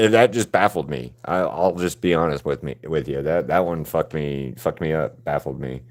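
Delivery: ordinary talk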